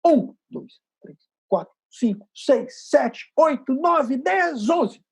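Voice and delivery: counting in a growing swell from piano to forte; crescendo count. Shown as a demonstration. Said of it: "a segunda bem pianinho, e a gente vai crescendo".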